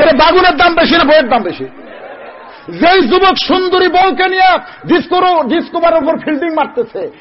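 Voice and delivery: high, forceful sermon delivery; fiery preaching. Acted. Preacher challenging husbands about their wives, fiery and high. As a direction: preach it high and punchy to the crowd.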